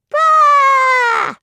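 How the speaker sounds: high loud shouted bah; crowd cry. Acted. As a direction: shout your agreement. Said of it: "the people cried in agreement".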